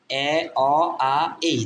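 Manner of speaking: এ, অ, আ, এই as four separate vowels; vowel sounds demo. Shown as a demonstration. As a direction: sound out এ, অ, আ, এই one by one. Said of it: "vowel এর উচ্চারণ কয় রকম?".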